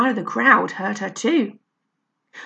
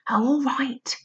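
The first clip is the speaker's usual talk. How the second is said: whisper; 4 semitones higher than usual. Acted. Barney agrees, whispered.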